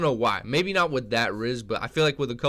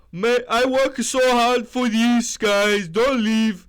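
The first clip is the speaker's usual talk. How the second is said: much higher, louder, slow put-on voice; Veggie Bite impression; 11 semitones higher, 10 dB louder, slower than usual. Mocking a fighter's emotional speech, high and slow. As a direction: squeeze it up high and drawn-out. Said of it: "That's my Veggie, Veggie Bite impression".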